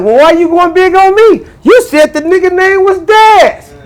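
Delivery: high, loud, slow indignant complaint; whiny protest; brother protesting. Retold or imitated. Brother objecting he was told Daz, high and slow.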